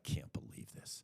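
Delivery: muttered under the breath, mildly annoyed; irritated mutter. Retold or imitated. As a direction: grumble it like a sore salesman. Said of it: "so irritated".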